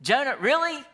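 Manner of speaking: God shaking his head at Jonah; God to Jonah, high and incredulous. Retold or imitated. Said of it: "looking at Jonah going".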